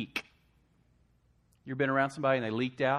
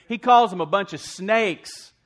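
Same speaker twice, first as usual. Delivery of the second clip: loud, slow, high declaration; emphatic preaching; 8 semitones higher, 13 dB louder than usual. Acted. Preacher telling the church, loud and slow. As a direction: hit it hard like a crescendo.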